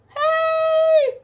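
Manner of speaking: very high playful yell; demo yell. Shown as a demonstration. Teacher showing children a yell, high playful shout. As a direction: show the kids what a yelp is. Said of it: "That means yell".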